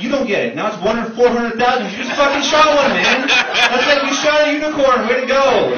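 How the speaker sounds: high, loud sarcastic cheer; mock him with a big fake celebration, up high and loud; mock excitement. Mocking friend's albino deer.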